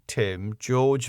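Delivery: ordinary delivery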